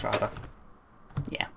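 ordinary delivery

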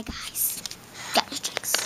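soft unvoiced whisper. Pretend waiter whispers as he brings the drinks. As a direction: whisper it like a secret.

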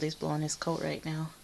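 ordinary talk, nothing marked

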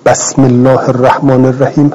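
بسم said "basm" with a short a; بسم opened with fatha as some Arabs do. Shown as a demonstration. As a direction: give the ب of بسم an a.

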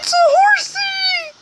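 very high, squeaky cartoon squeal; tiny high-pitched squeak of joy; squeaky horsey voice. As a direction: squeal it like a delighted child. Excited at spotting a horse.

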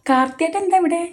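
high-pitched surprised question; startled. A narrator's reading. Shocked at finding Karthi here, startled. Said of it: "ഞെട്ടല് വിട്ടുമാറാതെ കാർത്തിയെ നോക്കി".

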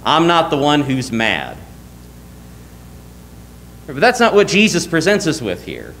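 usual speaking voice

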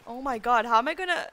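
usual speaking voice

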